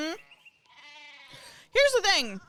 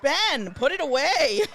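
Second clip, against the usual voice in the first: mock scolding; 3 semitones higher, 4 dB louder, slower than usual. Mock scolding Ben Franklin.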